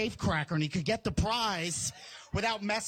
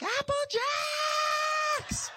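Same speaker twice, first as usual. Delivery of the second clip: high, stretched-out shout of triumph; hammed-up kid bragging about cereal; gleeful yell. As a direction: holler it and draw out the last word. Gleeful answer to brother about cereal.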